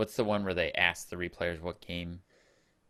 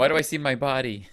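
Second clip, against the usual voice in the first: higher, louder mock fright; mock panic; 7 semitones higher, 8 dB louder, faster than usual. Mimicking Todd seeing ghost hands, in mock fright. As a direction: act the scared player seeing hands.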